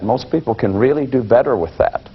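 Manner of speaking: ordinary talk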